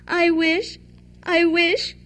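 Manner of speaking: a touch of tearful catch; mildly tearful, struggling to speak; choked with tears. A narrator's reading. Cinderella to the good fairy, lightly tearful. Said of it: "her voice was choked with tears".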